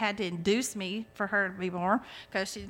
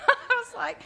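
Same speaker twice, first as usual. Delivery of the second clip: laughing through "I was like", pitched up; real laugh breaking into the words; 13 semitones higher than usual. Real, not acted. Admitting to the church she was mad at the lady, laughing. Laughs at her own anger.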